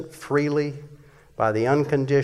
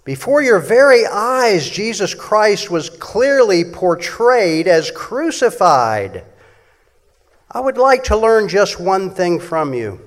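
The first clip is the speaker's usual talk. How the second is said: loud, high preaching read; fiery preacher's scripture reading; 3 semitones higher, 5 dB louder than usual. Shown as a demonstration. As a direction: proclaim it like a pulpit preacher. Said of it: "gonna read it just kind of like a preacher would".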